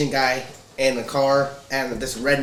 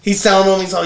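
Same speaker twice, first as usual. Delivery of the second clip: ordinary talk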